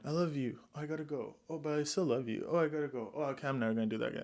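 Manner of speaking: soft, breathy mock lover's voice; hushed, whiny parody of the boyfriend; a mild mock of a lovesick vampire; mocking Edward. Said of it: "He's going".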